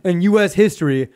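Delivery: loud, high, hammed-up shouting; mock patriotic yelling. Retelling boot camp, yelling about the war. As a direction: bellow it like a drill sergeant hyping the troops.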